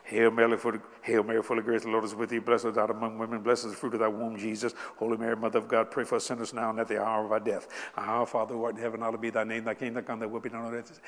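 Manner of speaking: low, rote, rushed recitation; rattled-off prayer. Retold or imitated. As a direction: rattle it off like penance. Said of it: "Don't you say it real fast".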